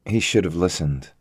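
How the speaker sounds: usual speaking voice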